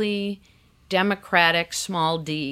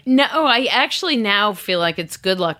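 laughing; 4 semitones higher, 6 dB louder than usual. Real, not acted. Laughing while answering.

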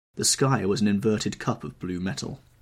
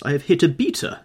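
high, loud cry of alarm; shocked exclamation; 8 semitones higher, 8 dB louder than usual. A narrator's reading. Geoffrey realising he shot a beater, loud alarmed cry. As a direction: blurt out the horror. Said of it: "exclaimed Sir Geoffrey".

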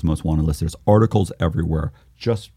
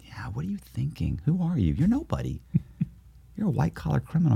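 half-whispered self-doubt, pitched up; hushed inner voice; 7 semitones higher, 9 dB quieter than usual. Retold or imitated. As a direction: voice a belittling thought under your breath. Retold inner voice doubting himself.

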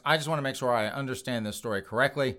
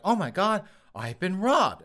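higher, lightly shocked gasp of a robbed woman; half-hearted imitation of a woman finding she's robbed; mock shock; 7 semitones higher, 3 dB louder than usual. Victim voiced waking up.

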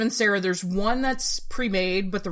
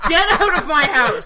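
mock outrage; 7 semitones higher, 6 dB louder than usual. Mock outrage at not seeing film.

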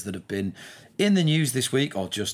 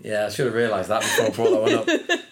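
laughing; 3 dB louder than usual. Real, not acted. Laughing after Emma's joke.

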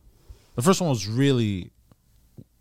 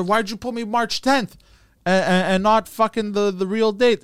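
son confronting father; 6 semitones higher, 4 dB louder than usual. Acted. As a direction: plead with dad, pitched up. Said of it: "he goes to his father".

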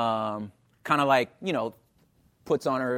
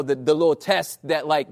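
ordinary talk

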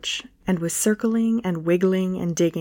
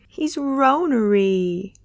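put-on slow, lisping baby talk; 3 semitones higher, slower than usual. A narrator's reading. Candace baby-talks about the lonely dog, slow and cutesy. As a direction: coo it like a baby. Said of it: "a baby voice emerged from her chest".

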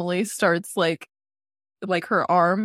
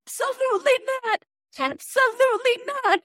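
[name]. Retold or imitated. Quoting the line that loops in her head, high-pitched impression. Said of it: "is [name] saying".

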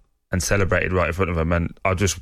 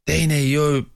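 higher, angry yell at a player; angry fan shout; 8 semitones higher, slower than usual. Retold or imitated. Angry fan shouting at player during warm-up, higher yell. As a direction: bark it like a furious fan from the stands. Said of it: "this geezer sprinted downstairs".

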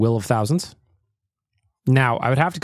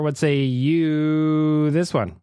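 slow dramatic; 3 semitones higher, much slower than usual. Acted. Host slows, dramatic.

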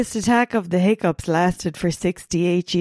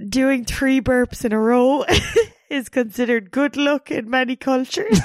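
trying not to laugh; 5 semitones higher than usual. Real, not acted. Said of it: "I can't even look at you".

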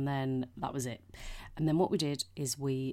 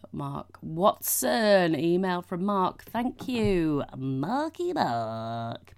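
slow, louder drawn-out announcement; announcer read; 3 semitones higher, 7 dB louder, much slower than usual. Acted. Introducing a listener email, slow and grand.

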